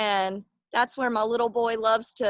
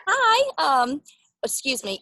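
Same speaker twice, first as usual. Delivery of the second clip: ordinary delivery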